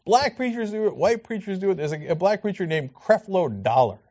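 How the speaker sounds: ordinary delivery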